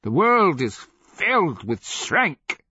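high, loud, drawn-out outburst; angry retort. A narrator's reading. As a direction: hurl it back, stretching the last words. Acted quarrel, slave retorts.

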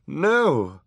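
high, loud protest; amazed cry. A narrator's reading. Judge amazed at the boy's surrender, loud high cry. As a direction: cry out in shock. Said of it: "cried in solitary amazement".

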